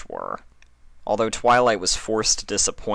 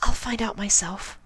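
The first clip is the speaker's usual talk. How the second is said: a much higher timid pony voice; reassuring Fluttershy. A narrator's reading. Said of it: "she reassured Twilight".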